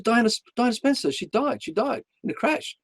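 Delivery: retold urgent announcement; urgent neighbour. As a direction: imitate an agitated neighbour bringing bad news. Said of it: "this woman comes running over saying".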